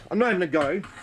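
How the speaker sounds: riled but holding it together; a bit pissed off. Real, not acted. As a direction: let real annoyance leak through. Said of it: "and I sound like I am".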